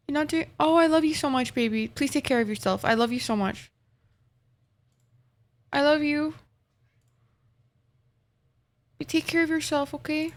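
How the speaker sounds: soft, tender lovey voice; sweet nothings. Real, not acted. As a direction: coo it softly like to a lover. Tender words to partner on stream.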